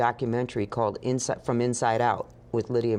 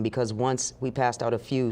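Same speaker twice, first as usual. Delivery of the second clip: ordinary talk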